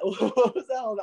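high, slow, laughing through it; laughing disbelief. Real, not acted. Repeating the question, laughing in disbelief. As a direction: giggle at the absurdity.

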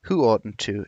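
ordinary delivery, nothing marked

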